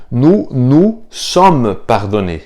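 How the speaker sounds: slow, careful "nous nous sommes pardonnés"; slow model phrase. Shown as a demonstration. Teacher repeats the French slowly for learners. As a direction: say the double "nous" slowly and clearly. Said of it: "E aí fica".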